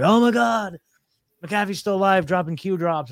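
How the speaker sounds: mock shock at a dead man posting; mock excited gasp. Online crowd gushing over McAfee's channel, mock excited. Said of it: "everyone's like".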